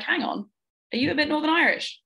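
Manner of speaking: high-pitched, retold double take; surprised realisation. Listener catches out the speaker's hidden accent, mock surprise. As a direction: imitate someone catching on, voice jumping high.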